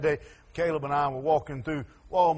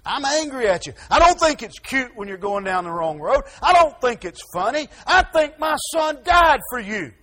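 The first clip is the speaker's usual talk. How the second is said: rising, louder, pained rebuke; angry God; 7 semitones higher, 5 dB louder than usual. Acted. God rebuking those who turn away, building to a shout. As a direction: build from hurt to outrage.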